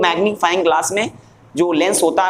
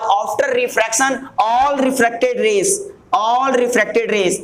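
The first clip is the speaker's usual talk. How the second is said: stretched-out words for note-taking; slow dictation; 3 semitones higher, much slower than usual. Shown as a demonstration. Teacher giving students the lens definition, slow dictation. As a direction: spell out the phrase slowly and deliberately.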